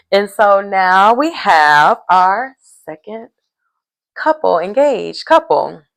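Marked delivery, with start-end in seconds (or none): none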